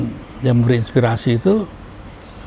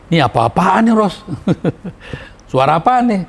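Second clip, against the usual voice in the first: scornful disbelief; 6 semitones higher than usual. Retold or imitated. Director rejects the singer's voice.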